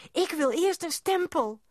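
put-on high, loud childish demand; insistent demand. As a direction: insist loudly, pitched right up. Child insists on getting stamp.

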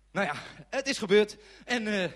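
increasingly sheepish self-justifying; flustered defensiveness. Acted. Storyteller insisting he made it back, flustered and squeaky.

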